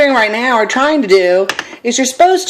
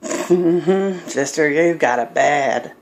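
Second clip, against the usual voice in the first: sassy onlooker; 5 semitones lower, 5 dB quieter, much slower than usual. Retold or imitated. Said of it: "looking at you like".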